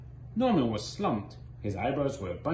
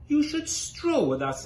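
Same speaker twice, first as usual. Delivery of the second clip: high preening peacock voice; proud peacocks; 12 semitones higher, 3 dB louder than usual. Acted. The peacocks invite Jim to stroll, high and preening. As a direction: lift it up high like a preening bird.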